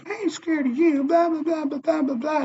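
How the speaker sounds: very high, drawn-out mocking mimicry; mock her bravado way up high; imitated defiant woman. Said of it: "She goes".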